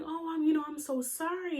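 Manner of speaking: high-pitched retold sympathy; mother's sympathy. Retelling mother's sympathetic reaction.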